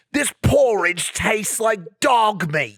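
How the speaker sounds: very slow, drawn-out British insult; mock British chef, savouring each word; slow hammed-up British jab; British chef accent. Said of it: "What do they say?".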